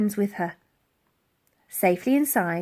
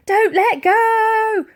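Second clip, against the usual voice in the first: high, loud, slow shout; girl shouting to her friend; 13 semitones higher, 9 dB louder, much slower than usual. A narrator's reading. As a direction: bellow it out over the noise. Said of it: "shouted the girl".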